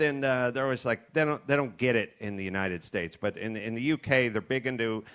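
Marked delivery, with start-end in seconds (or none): none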